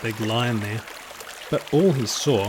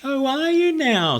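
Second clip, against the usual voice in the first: high, louder mock surprise; teasing disbelief; 13 semitones higher, 4 dB louder than usual. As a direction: humour him with an amused oh.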